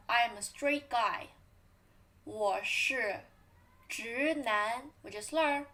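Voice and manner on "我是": ordinary talk, nothing marked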